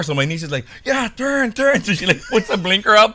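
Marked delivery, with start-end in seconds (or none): 0.8-1.8 high, excited mock urging; pumped up. Niece urging the car ahead, pumped up. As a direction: egg the driver on in a high voice. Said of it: "she's all pumped up".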